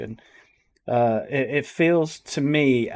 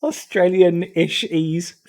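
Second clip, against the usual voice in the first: drawn-out, higher, louder mocking echo; incredulous mimicry; 5 semitones higher, 6 dB louder, slower than usual. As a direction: parrot it back slowly in disbelief. Repeats the clip's mangled phrase.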